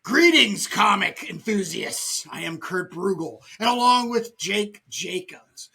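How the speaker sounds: hammed-up podcast announcer intro; best announcer voice. Shown as a demonstration. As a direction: read it like a show announcer with big punched openings. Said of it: "I'm gonna do my best announcer voice".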